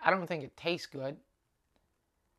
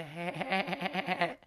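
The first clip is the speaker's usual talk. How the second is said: mocking staccato honking laugh; Fran Drescher laugh; 2 semitones higher than usual. Comic mocking the friend's annoying laugh. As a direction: imitate a grating, rapid-fire laugh. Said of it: "punch him in the face for that laugh laugh".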